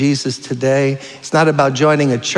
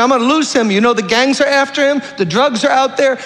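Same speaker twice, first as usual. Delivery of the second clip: much higher, tearful pleading mother; weeping mother's prayer; 8 semitones higher than usual. Retold or imitated. Preacher imitating the mother weeping over her son, high and pleading. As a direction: cry out to God for your son. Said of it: "And she's just weeping".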